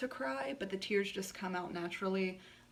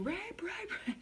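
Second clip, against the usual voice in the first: retold screaming; 11 semitones higher, slower than usual. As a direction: cry it out high, as remembered. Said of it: "I just started screaming".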